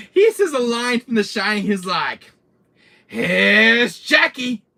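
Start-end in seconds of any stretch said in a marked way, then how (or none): 3.1-4.6 high, loud, slow yell; hammed-up horror villain entrance; manic villain shout. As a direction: stretch the first word and holler it. Villain's Shining line, repeated.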